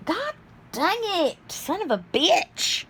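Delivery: high, drawn-out frustrated swearing; strained, high-pitched exasperation; real exasperated cursing. Real, not acted. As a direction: curse slowly at something that won't cooperate. Cursing while struggling with heating pad.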